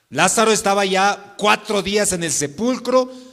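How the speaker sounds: normal talking voice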